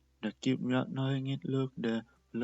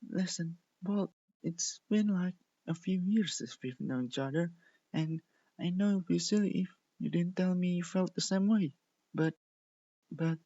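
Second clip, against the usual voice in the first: nervous confession; 6 semitones higher than usual. Acted. Cotton Candy confessing to Prism Bolt, nervous and higher. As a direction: stumble toward the confession.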